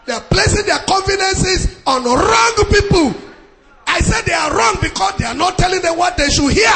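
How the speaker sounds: loud, high-pitched pulpit shout; impassioned preacher raising the roof; full-force preaching. Acted. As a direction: thunder it out to the congregation.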